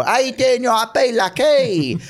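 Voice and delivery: put-on a high, loud London geezer accent with a slow, stretched "Be lucky"; London accent, comic imitating a fellow stand-up; Rob Beckett voice. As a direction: do a chirpy South London comic. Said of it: "I end up speaking like both of them".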